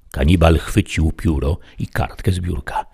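plain reading voice

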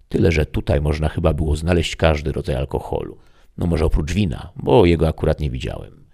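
ordinary delivery

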